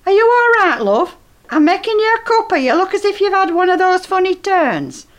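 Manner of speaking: chirpy cover-up to the dazed neighbour; falsely cheerful fussing. Acted. Old woman sweetly fussing over her neighbour to hide the doll.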